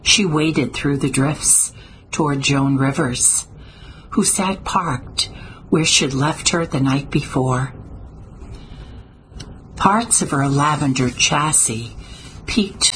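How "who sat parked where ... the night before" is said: ordinary delivery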